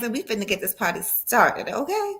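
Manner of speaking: ordinary talk, nothing marked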